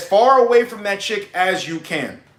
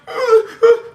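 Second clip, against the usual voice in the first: high, louder fake sobbing gasps; mock sobs; 5 dB louder than usual. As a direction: sob it out in a hammed-up falsetto. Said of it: "She's crying".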